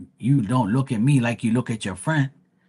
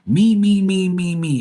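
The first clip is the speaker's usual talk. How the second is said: higher, louder mock chant of "me"; mocking needy viewer; 5 semitones higher, 5 dB louder than usual. Mocking the viewer who wanted attention, chanting a higher, louder me. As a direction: whine it like a spoiled attention seeker. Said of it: "show you what type of person".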